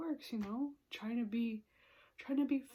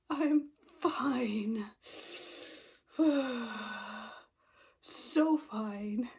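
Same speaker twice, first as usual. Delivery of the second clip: retold brave-face fine, the last one loud; forced "I'm fine"; 5 dB louder, much slower than usual. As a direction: insist you're fine while about to cry. Insisting she's fine, holding back tears.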